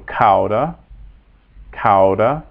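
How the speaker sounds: "cauda" as COW-da; careful Latin "cauda", first syllable like cow; ow diphthong. Shown as a demonstration. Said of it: "makes the ow sound".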